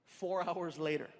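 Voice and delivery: normal talking voice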